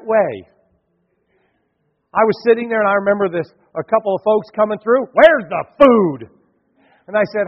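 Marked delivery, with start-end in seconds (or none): 5.1-6.3 mock rude demand. Rude visitors demanding food.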